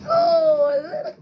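high, loud startled cries; loud surprised yelps. Real, not acted. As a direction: yelp out in surprise. Game surprise.

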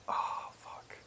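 acted whispered curse under the breath; hushed, helpless aside; dismayed whisper. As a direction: whisper it in mock dread. Acted dismay at incomprehensible man.

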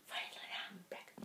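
hushed whisper. Pretend. Vlogger echoing the comeback to viewers, whispered. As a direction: whisper it under your breath.

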